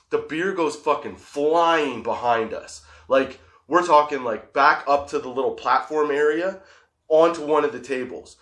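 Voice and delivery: normal talking voice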